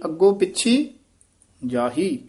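ਪਿੱਛੀ rises, then a pause before ਜਾਹੀ; pause after ਪਿੱਛੀ. Shown as a demonstration. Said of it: "ਇੱਥੇ ਪਿੱਛੀ ਤੇ ਠਮਕ". (ਪਿੱਛੀ ਤੇ ਠਮਕ)

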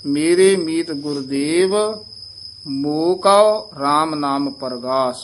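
rest after Gurdev, then a slow ਮੋਹ ਕਉ; careful recitation; pause after Gurdev, stress ਕਉ. Shown as a demonstration. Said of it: "Gurdev ਤੇ ਵਿਸਰਾਮ ਹੈ".

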